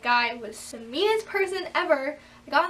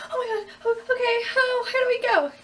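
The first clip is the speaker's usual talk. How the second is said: much higher, near tears, squeaky panic; panicked; 10 semitones higher, 4 dB louder than usual. Retold or imitated. Nervous teen in the driving test car, panicking high. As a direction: fall apart in a squeak. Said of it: "I was really scared".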